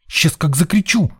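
put-on high-pitched boy's threat; young blackmailer's threat. A narrator's reading. Boy blackmails the man holding him, high and threatening. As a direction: threaten like a kid about to holler. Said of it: "юный шантажист, набирая побольше воздуха".

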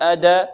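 أد ending in a clear "a", "ada"; wrong fatha tilt. Shown as a demonstration. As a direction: add an "a" after the d of أد. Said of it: "لا نقول أد".

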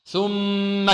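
"ثم" with a long hummed doubled m; ghunnah. Shown as a demonstration. Teacher reciting for students, long ghunnah on the mim. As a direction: hold the nasal m in "ثم" before releasing. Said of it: "ما حكمها؟ حكمها الغنة".

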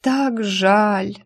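mock-tragic moan; hammed-up groan of pity. Heroine faking grief for Alisa, hammed-up moan. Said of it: "простонала".

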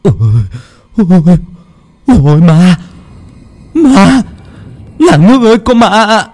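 very high, loud screams of terror; terrified scream. Acted. Fallen man screaming to the village about a ghost, terrified shriek. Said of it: "ngã lăn ra đất mà ú ớ".

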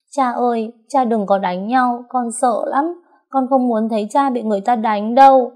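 put-on a frightened little girl begging her father; crying little girl. A narrator's reading. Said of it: "Xíu khóc nấc lên".

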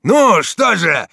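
high, loud, drawn-out cry; challenging shout. A narrator's reading. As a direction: goad your attackers. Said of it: "закричал Дон Фернанд". (крик с вызовом)

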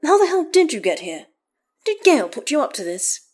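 high, loud astonished questions; incredulous questioning. A narrator's reading. Astarion asking how Karlach got here, incredulous. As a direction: demand to know in disbelief. Astarion demands explanation of her arrival.

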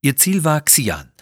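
ordinary delivery, nothing marked

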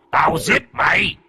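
threat rising into a high yell; ghostly shout. Acted. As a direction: scream the threat at him.

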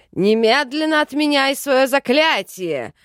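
loud, high furious shout; raging. A narrator's reading. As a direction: roar the order in a rage. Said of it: "бесновался Джин". (яростный крик)

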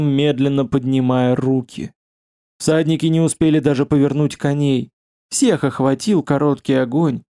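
ordinary delivery

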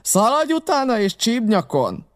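high, urgent shouted order; Silver's shout. A narrator's reading. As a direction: call out to send a man running. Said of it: "kiáltotta Silver".

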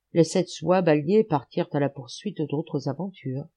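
ordinary delivery, nothing marked

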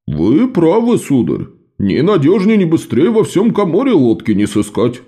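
a hint of a smooth-talking young boatman. A narrator's reading. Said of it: "отозвался молодой лодочник".

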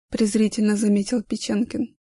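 plain reading voice

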